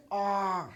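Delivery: soft, flat disappointed "Ah."; bad ah. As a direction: let it sag in letdown. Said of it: "Now, there is a bad ah".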